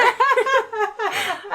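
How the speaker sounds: real laugh, pitched high; high laughter. Real, not acted. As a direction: keep laughing helplessly. Laughter continues.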